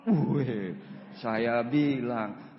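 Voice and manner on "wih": ordinary delivery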